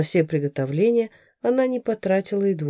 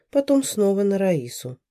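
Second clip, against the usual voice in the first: plain reading voice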